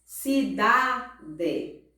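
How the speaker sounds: "Cidade" split slowly as ci-DA-de, weight on da; syllable by syllable. Shown as a demonstration. Teacher gives paroxytone example, syllable by syllable. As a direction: separate "Cidade" into syllables, leaning on da. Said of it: "vamos para os exemplos de paroxítona".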